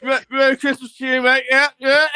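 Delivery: trying to act normal while high; nervous cheery reply to a neighbour; flustered greeting. Retold or imitated. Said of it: "I was like".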